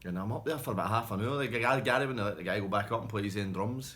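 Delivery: normal talking voice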